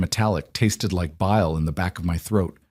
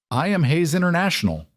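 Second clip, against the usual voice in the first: snapping; 9 semitones higher than usual. A narrator's reading. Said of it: "Declan snapped".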